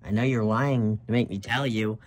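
plain reading voice

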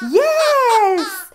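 high, loud gleeful yes; hammed-up puppet delight; excited puppet cheer. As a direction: squeal it like a thrilled puppet.

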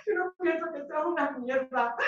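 high-pitched, insistent reassurance; high pleading denial. Acted. Pleading denial, then louder repeat.

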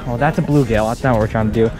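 ordinary delivery

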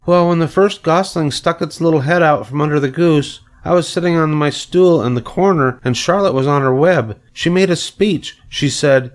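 put-on high, eager little-girl storyteller; Fern. A narrator's reading. Fern tells parents about Charlotte's speech, eager child.

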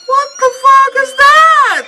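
high, startled exclamation; shocked outburst. Real, not acted. Viewer reacting to a creature on screen, shocked outburst. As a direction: cry out in alarmed disbelief.

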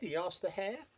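ordinary delivery

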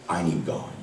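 quietly confessing "I need God"; quiet plea. Acted. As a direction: drop to a near hush on "I need God". Preacher repeats his plea.

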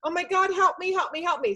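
high, frantic plea from a mother begging for help; pleading wildly for her jailed son; panicked mother. Retold or imitated. Mother begging the airman for help, frantic and pitched high. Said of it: "she was like".

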